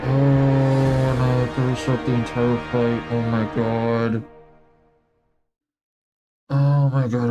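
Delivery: ordinary delivery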